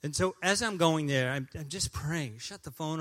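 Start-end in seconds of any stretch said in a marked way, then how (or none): none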